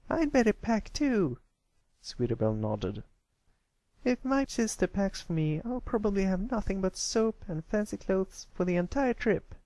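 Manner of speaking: chirpy little filly saying goodbye; Sweetie Belle's voice. Acted. Filly heads off to pack and bids friends bye, high sweet voice.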